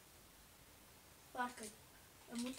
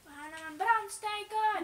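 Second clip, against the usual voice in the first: excited; 5 semitones higher, 8 dB louder than usual. Real, not acted. Excited plan to burn boat.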